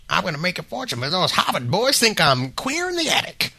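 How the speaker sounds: put-on high, rambling old coot voice; old prospector. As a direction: brag like an eccentric old inventor. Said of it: "Does he actually say that?".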